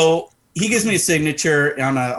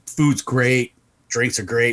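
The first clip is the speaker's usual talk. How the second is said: usual speaking voice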